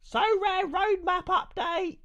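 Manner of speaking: very high, slow, stretched mock-hype yell; hype shout. Streamer hyping his viewers over the update, very high stretched yell. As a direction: scream it like a hyped announcer.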